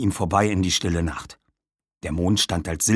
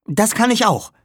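high, louder, irritated retort; snapping; 13 semitones higher, 5 dB louder than usual. A narrator's reading. Tamara retorts she can raise fire too, snapping. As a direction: snap back competitively. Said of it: "fauchte Tamara".

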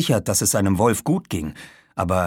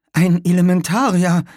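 high, louder nervous statement; name the threat with raised, nervous pitch; 10 semitones higher, 6 dB louder than usual. A narrator's reading. Girl identifies the monster to her friends, nervous. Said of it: "sagte Tamara nervös".

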